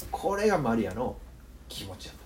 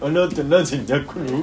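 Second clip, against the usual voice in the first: redone Shin-chan voice, louder; 5 dB louder than usual. Retold or imitated. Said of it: "クレヨンしんちゃんの真似が上手にできなかった人みたいな".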